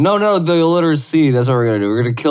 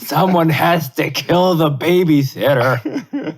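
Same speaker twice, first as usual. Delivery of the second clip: mock villain; 3 semitones higher, slower than usual. Mock villain joke line.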